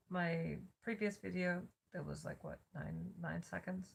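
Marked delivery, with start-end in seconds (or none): none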